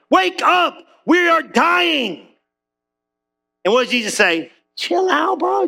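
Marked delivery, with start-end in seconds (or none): 0.1-2.3 panicked disciples. Acted. 4.8-5.7 high, easy-going "chill out, bro"; cool laid-back Jesus. Acted. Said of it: "Jesus slick. He cool".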